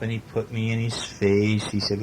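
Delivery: ordinary delivery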